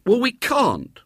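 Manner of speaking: high-pitched schoolboy indignation; indignant. A narrator's reading. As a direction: protest indignantly, pitch shooting up. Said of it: "said William indignantly".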